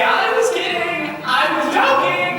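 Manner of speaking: high, slow, sheepish take-back; mock backpedal. Groom at the altar trying to take it back, sheepish. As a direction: squeak out a lame retraction.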